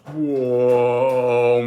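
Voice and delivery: long drawn-out, loud slow-motion "whoa". Retold or imitated. Class watching in slow motion, long drawn-out mock cry. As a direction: stretch it out like slowed-down film. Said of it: "it was like slow motion".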